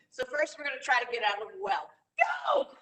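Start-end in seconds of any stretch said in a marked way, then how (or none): 2.2-2.7 laughing shout. Shown as a demonstration. Coach starts the escape with a laughing shout. Teacher launches well escape exercise.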